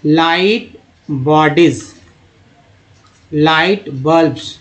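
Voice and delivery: drawn-out syllables with breaks inside words; slow word modelling. Shown as a demonstration. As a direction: read each term slowly, part by part. Teacher models terms part by part.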